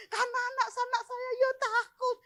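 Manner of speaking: high-pitched, worked-up plea; agitated exclamation. Real, not acted. Preacher telling the congregation her children were afraid, high exclamation.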